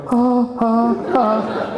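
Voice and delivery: a forced, awkward little laugh; mock-laugh like a put-off client; fake polite laugh. Coach imitating an uneasy client's forced laugh. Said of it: "they're going".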